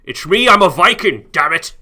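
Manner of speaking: mock Norse accent, loud and offended; Viking accent. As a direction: shout it as an indignant Viking captain. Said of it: "that's what it sounded most like to me".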